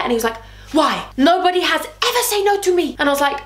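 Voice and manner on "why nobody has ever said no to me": high, indignant mock Frenchman's accent; bad French accent. Rejected boyfriend protests being refused, bad French accent. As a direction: mimic his wounded ego in French accent. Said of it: "doing a really bad French accent".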